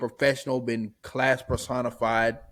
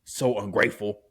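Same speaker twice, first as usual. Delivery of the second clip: lightly voiced menacing crime boss; Kingpin voice; 5 semitones lower than usual. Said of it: "Why am I talking like Kingpin?".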